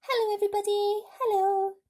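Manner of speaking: high, loud cheerful call; a boy hailing the crowd from above. A narrator's reading. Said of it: "laughing and waving and calling out".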